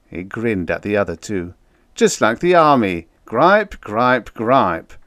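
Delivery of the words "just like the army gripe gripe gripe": mocking. A narrator's reading. Town mocking.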